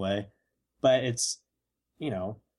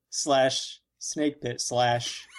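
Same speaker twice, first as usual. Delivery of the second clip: slow listing; much slower than usual. Pretend. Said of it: "slow drawn list joke".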